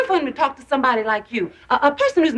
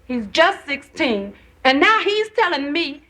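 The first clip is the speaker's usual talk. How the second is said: usual speaking voice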